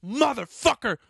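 high, loud yell threatening the police; retold enraged screaming. Threatening to call nine one one, retold enraged screaming. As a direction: re-enact screaming threats, peaking on the final question. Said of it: "I'm screaming".

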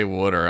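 low "wooder", rhyming with gooder; mom's Philly pronunciation. Retold or imitated. Retelling mom saying water, Philly "wooder". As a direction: quote mom's "wooder" with a wood vowel. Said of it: "every time I hear her say".

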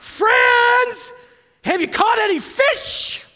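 a high, loud call across the water; Jesus calling out to the fishermen; yelling out. Retold or imitated. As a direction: shout it from the shore to the boat. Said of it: "He yells out".

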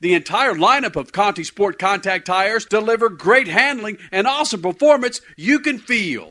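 upbeat, punchy announcer ad read; radio ad read. Acted. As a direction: sell it like a radio spot. Live sponsor ad read.

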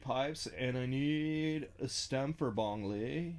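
lazy, slow stoner drawl; laid-back stoner, slow on the words. Pretend. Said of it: "slip into stoner voice".

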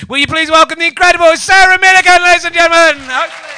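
loud, high host's welcome; big showbiz announcement; announcer voice. Acted. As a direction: belt out the guest introduction. Announcing the guest onstage.

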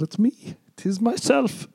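high-pitched little Irish goblin; squeaky goblin answering up high. Acted. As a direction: pipe up high as an impish goblin. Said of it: "Irish slurring goblin".

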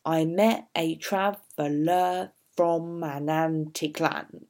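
"I met a traveller from an antique land" read with every second syllable stressed; iambic beat. Shown as a demonstration. Teacher reading the line to show iambic pentameter, stressed beats. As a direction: tap out the iambic rhythm on "met", "trav", "from", "tique", "land". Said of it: "it's in iambic pentameter".

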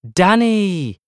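high, loud joyful cry; delighted cry. A narrator's reading. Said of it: "my Aunt Rika cries".

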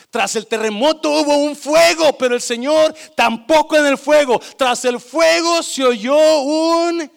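shouted climax. Acted. Preacher to congregation, booming about the fire.

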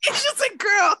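squealing high outrage; high excited rant. Real, not acted. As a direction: shriek it in disbelief. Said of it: "rant peaks".

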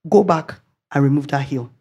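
lightly voiced divine instruction; a clear voice from God. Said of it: "a very clear voice".

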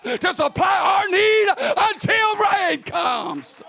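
bold prophet's shout. Acted. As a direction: proclaim it like a prophet sure of God. Preacher acts out prophet's bold answer.

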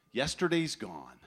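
normal talking voice

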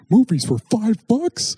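high, drawn-out disbelief at the price; incredulous future listeners. Acted. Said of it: "we'll be like".

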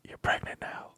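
breathy whisper; whispered bedroom line. Retold or imitated. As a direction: whisper it close in her ear. Whispered punchline of bedroom tale.

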